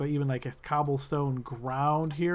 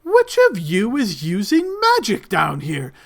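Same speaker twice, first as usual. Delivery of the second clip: very loud, much higher, slowing indignant yell; outraged guard; 10 semitones higher, 11 dB louder than usual. Acted. Guard catching someone using magic, outraged shout. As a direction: rage at the unseen magic user. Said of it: "he pauses for a moment. He looks around".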